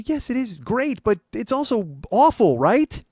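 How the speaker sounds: high, loud and emphatic retort; exasperated insistence. Retold or imitated. Man arguing with his friend that it's awful, exasperated insistence. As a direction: push the point loudly, ending on a pleading question. Retold argument with friend on phone.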